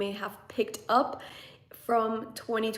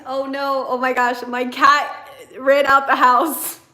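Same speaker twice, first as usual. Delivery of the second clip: loud, higher cry of sudden dismay; alarmed outburst; 4 semitones higher, 11 dB louder than usual. Real, not acted. Meditation host spots her cat escaping mid-stream, alarmed. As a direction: blurt it out in real alarm, stretching the end.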